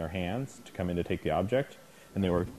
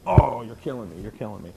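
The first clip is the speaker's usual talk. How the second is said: mock pain; 3 semitones higher, 7 dB louder than usual. Decoy fakes pain for the dog.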